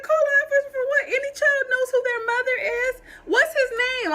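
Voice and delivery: high, loud, fast outraged shout; furious yell. Retold or imitated. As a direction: yell it back, fast, in disbelief. Mother furious at the park ranger.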